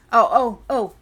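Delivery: loud startled oh oh oh; sudden realization. Real, not acted. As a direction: jolt as it comes back. Sudden remembering outburst.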